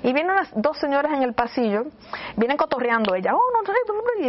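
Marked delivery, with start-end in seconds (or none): 3.3-4.3 high squeaky gossiping ladies; playful imitation of gossiping ladies; mock chatter. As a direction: mimic the chattering women, pitched way up.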